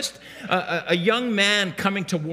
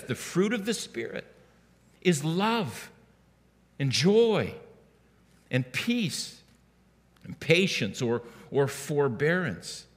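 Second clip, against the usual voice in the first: scripture reading; 3 semitones lower, 4 dB quieter than usual. Acted. Reciting scripture slowly.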